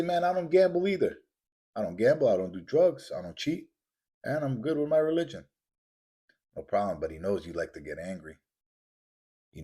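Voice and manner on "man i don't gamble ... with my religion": lightly voiced protester, pitched up; objecting listener. Listener defending himself to the preacher, mock protest. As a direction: put on a mild higher-pitched voice of someone protesting.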